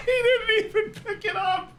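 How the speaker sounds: high, breaking into laughter mid-sentence; can barely get it out for laughing; squeaky helpless laughter; laughing hard. Real, not acted. Streamer cracking up at captions.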